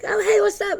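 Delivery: high, airy hello from the ether; chirpy spirit greeting. Retold or imitated. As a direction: greet like a bright little spirit. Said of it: "it's like".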